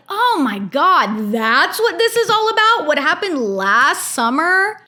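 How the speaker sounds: sudden high disbelieving gasp of a question; shocked realization. Retold or imitated. Vlogger replaying her shock at the reunion fight, disbelieving. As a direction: act out gaping at the screen. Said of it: "And I'm watching this and I'm like".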